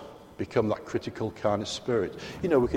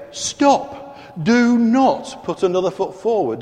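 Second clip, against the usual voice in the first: sharp, loud, high command to halt; urgent warning; 8 semitones higher, 5 dB louder than usual. Acted. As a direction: call out to stop a child at the road's edge. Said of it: "What do you say to a child just about to run out into a busy road?".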